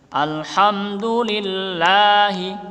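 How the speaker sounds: Alhamdulillahi, the hu kept to one beat; short hu in Alhamdulillahi; one harakat. Shown as a demonstration. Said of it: "cukup satu harokat".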